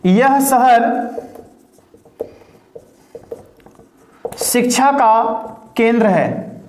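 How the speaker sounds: very slow, long pauses between words; slow dictation with long gaps. Shown as a demonstration. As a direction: give the class time to write each word. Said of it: "dictates Hindi sentence word by word".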